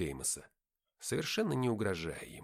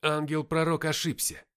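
strained, raised voice; effortful, higher-pitched utterance; speaking with difficulty; 6 semitones higher, 7 dB louder than usual. A narrator's reading. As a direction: force it out despite injury. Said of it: "сказал я с трудом".

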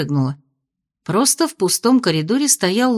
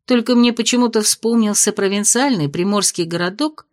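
plain reading voice

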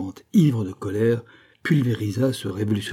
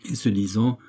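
ordinary delivery, nothing marked

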